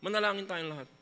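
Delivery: ordinary delivery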